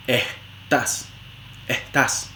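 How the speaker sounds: "estás" as "ehtás", whispered with h before the t; aspirated s before consonant. Shown as a demonstration. Teacher showing s aspiration before a consonant. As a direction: breathe the first s of "estás" as an h. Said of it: "antes de una consonante".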